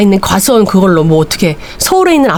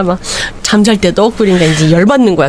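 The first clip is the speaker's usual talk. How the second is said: usual speaking voice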